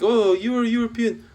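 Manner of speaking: high, loud mocking voice of a clueless guy; mocking quote. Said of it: "this one guy said".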